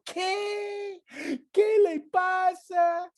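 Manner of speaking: high, mocking voice of scandalized churchgoers; mocking gossip. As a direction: mimic the gossiping crowd with a sneer. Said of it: "Y todo el mundo". (voz burlona y aguda de la gente chismosa)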